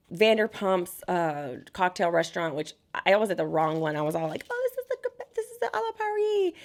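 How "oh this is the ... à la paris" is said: much higher, airy put-on posh tone; mock fancy self. Mocking herself at the wrong restaurant, much higher airy voice. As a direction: play your past self as a clueless fancy tourist.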